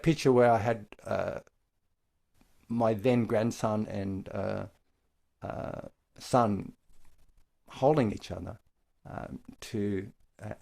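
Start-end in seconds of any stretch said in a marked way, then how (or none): none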